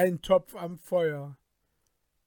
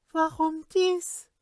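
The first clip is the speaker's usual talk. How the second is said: high sorceress voice; 11 semitones higher than usual. A narrator's reading. Sorceress asks the king.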